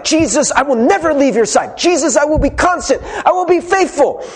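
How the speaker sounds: high, rising, almost sung pledge; earnest vow. Retold or imitated. Peter vowing loyalty to Jesus, high and almost singing. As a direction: swear it with everything, voice lifting. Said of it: "He's like".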